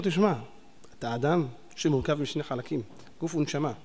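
soft explaining voice. Retold or imitated. Mock explainer to sinner.

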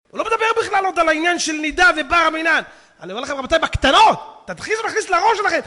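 loud, high, emphatic sermon delivery; full-force preaching. Real, not acted. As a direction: drive the warning into the congregation. (דרשה נלהבת בקול רם)

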